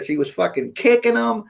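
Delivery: ordinary talk, nothing marked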